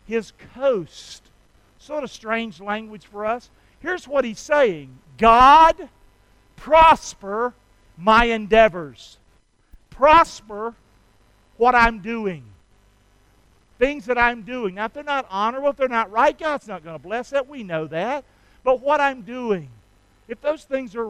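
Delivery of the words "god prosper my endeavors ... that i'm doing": loud, earnest praying; voicing a prayer to God; fervent prayer. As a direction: pray it out with passion. Preacher voicing Jabez prayer.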